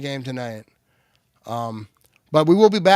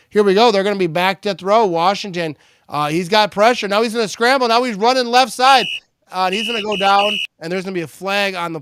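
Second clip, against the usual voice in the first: louder, higher call as the play unfolds; live play-by-play; 6 semitones higher, 8 dB louder than usual. Real, not acted. Streamer calling a quarterback scramble, live play-by-play.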